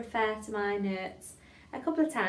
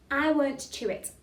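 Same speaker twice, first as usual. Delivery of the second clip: louder, carefully articulated line; tongue-twister demo; 5 semitones higher, 4 dB louder than usual. Shown as a demonstration. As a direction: model each word clearly for a class. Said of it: "let's say that one".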